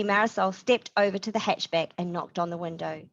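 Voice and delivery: plain reading voice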